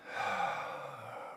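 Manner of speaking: long soft exhale; relaxing sigh. Shown as a demonstration. Meditation host connecting to the breath, long soft sigh. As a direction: breathe out slowly and let go.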